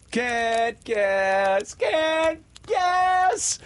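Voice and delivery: put-on high, loud, taunting boyish crow. A narrator's reading. Peter taunts the baffled pirates, crowing loudly. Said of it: "crowed Peter".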